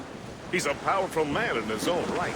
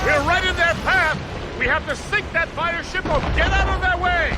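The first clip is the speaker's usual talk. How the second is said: urgent battle shout; 11 semitones higher, 5 dB louder than usual. Acted. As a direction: yell it high and loud over cannon fire. Crew shouts warning in battle.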